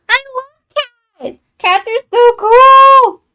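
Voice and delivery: very high, loud, drawn-out squeal of delight; gushing cat squeal. Acted. Gasping over cats while reading chat, high and loud. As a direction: squeal it like a kid who just saw a kitten.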